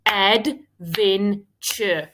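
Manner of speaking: adventure drawn out very slowly in syllables; sounding out words. Shown as a demonstration. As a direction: stretch adventure, ad-ven-ture. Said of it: "let's break it into syllables".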